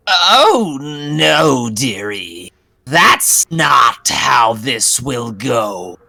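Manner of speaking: put-on slow, sly old witch voice. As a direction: grin and refuse calmly. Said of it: "Like that".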